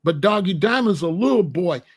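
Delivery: normal talking voice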